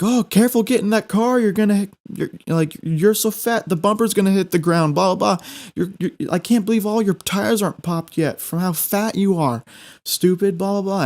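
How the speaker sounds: high jeering taunts; mock him like a bully; mocking heckler voice. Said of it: "the guys are still chirping".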